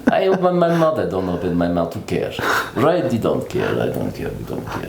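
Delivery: lazy, low Russian accent; sit back into a lazy Russian accent. Shown as a demonstration. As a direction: careless Russian-accented English. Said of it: "the most fun accent for me is Russian".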